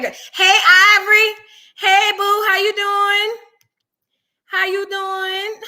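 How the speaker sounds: lightly voiced Wendy Williams, slow drawn-out "How you doing?" with a rising end; a hint of the talk-show host greeting, stretched slow. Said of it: "In my Wendy Williams voice".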